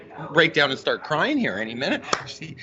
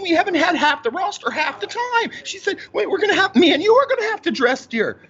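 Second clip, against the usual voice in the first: much higher, laughing mock mother; high, giggly hammed-up mom; 9 semitones higher than usual. Son voicing his mom about the injured roster.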